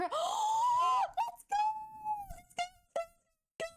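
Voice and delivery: real victory scream; celebratory shout. Real, not acted. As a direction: cheer at the top of the voice. Commission confirmed, celebrating.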